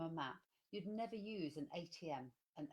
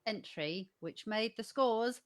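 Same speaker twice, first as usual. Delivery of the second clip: dramatic reveal; 2 semitones lower, 10 dB louder, slower than usual. Real, not acted. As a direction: drag out the suspense, then burst out the result. Announcing pie result after drum roll.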